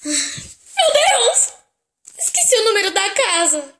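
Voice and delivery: high, slow, loud dismay; dismayed surprise. Acted. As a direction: gasp it out in alarm. Remembers forgotten number. (espanto exagerado)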